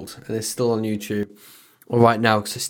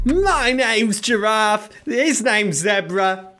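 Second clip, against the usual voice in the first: mock announcer intro; 7 semitones higher, slower than usual. Joke intro over music.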